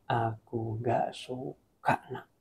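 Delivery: slow, soft, low voice of God; a fatherly God, hushed and slow; gently disapproving, each word drawn out; God's voice. Acted. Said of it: "Kalau Tuhan bilang lagi".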